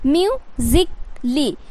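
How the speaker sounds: म्यूजिकली said slowly, the ली drawn out; correct pronunciation. Shown as a demonstration. Teacher slowly models म्यूजिकली for students. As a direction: spell out म्यूजिकली slowly for the class. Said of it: "मतलब आधा क होगा और लहर सी का ली".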